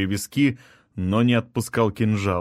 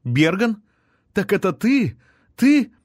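high, rising disbelief, climbing with each question; stunned high-pitched questioning; shocked recognition; 10 semitones higher, 4 dB louder than usual. A narrator's reading. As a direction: gasp it out, ending very high and louder.

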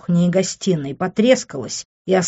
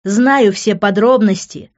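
smug, high-pitched taunt; smirking; 4 semitones higher, 6 dB louder than usual. A narrator's reading. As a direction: rub it in with a smirk. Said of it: "ухмыльнулся Тео".

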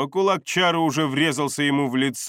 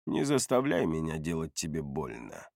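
low, soft menace; quiet threat; 7 semitones lower, 8 dB quieter than usual. A narrator's reading. Narrator warns Бардак he will hurt him, quiet threat. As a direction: warn him softly, pitched down.

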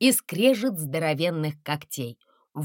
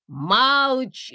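higher, louder roar; 6 semitones higher, 5 dB louder than usual. A narrator's reading. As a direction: roar it out from the fog. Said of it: "взвыли из тумана".

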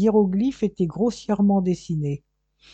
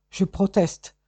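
higher, louder indignant protest; hissed protest; 4 semitones higher, 6 dB louder than usual. Acted. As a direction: protest sharply and high. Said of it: "siffla Vacariadès".

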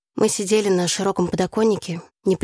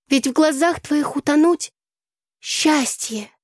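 slow, higher declaiming, long vowels; solemn recitation; 9 semitones higher, slower than usual. A narrator's reading. Tasya rehearses a love poem on the windowsill, solemn declaiming. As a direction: draw the verse out with ceremony. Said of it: "начала торжественно Тася".

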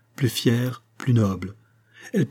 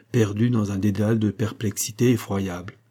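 ordinary delivery, nothing marked

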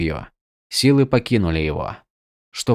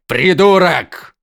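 drawn-out angry yell; 8 semitones higher, 4 dB louder, much slower than usual. A narrator's reading. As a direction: bellow the insult slowly. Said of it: "заорал Зайхель".

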